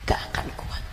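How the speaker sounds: hushed final warning; whisper. Acted. The ustazah quietly repeats the warning to the congregation, whispered. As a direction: breathe the warning out. After loud preaching, drops to whisper.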